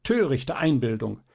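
scornful, furious retort to the doctor; angry. A narrator's reading. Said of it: "rief das Fräulein zornig". (zornig, immer höher rufend)

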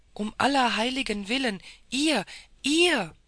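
aghast outcry; horrified cry. A narrator's reading. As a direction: gasp it out in disbelief. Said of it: "rief die Scuderie".

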